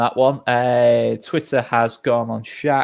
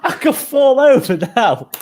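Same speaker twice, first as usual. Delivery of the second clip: slow and high through laughter, much louder; laughing; 11 semitones higher, 6 dB louder, slower than usual. Real, not acted. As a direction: laugh your way through the line, high and drawn out. Joking about falling over laughing.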